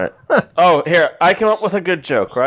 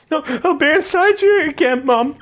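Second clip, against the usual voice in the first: hammed-up wailing, much higher and louder; mock sobbing; 4 dB louder than usual. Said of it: "He'd be like".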